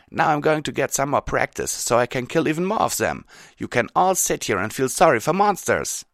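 loud, high parting shot; defiant Rainbow Dash. Acted. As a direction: fling it at them as you leave.

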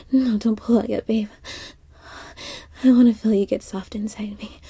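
soft intimate afterglow whisper. Acted. To her lover after sex, soft whisper. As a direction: breathe it close, barely voiced.

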